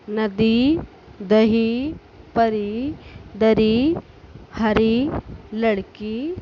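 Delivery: loud word-by-word drill; teacher leading a reading drill; classroom drill. Shown as a demonstration. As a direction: call out each word for the class to repeat. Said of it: "ऐसे ही पढ़ो और बोलो".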